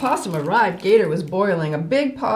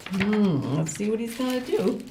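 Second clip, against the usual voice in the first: plain reading voice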